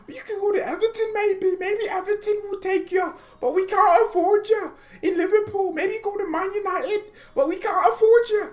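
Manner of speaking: mock a club turning a player down; high-pitched caricature of a broke club; mock Liverpool voice. Voicing Liverpool club rejecting Werner.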